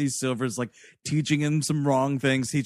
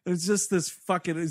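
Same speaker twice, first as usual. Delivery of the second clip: ordinary delivery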